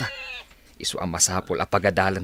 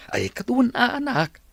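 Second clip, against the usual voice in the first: surprised. Acted. Father surprised by daughter's question.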